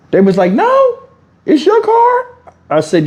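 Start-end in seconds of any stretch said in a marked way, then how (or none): 0.5-2.2 put-on high squeaky little kid. Boys caught at the car, squeaky kid reply. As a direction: pitch way up like a surprised child. Kids answering from the parking lot.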